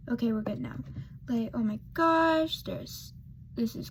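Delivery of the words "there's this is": whispered aside. Real, not acted. Makeup aside while filming.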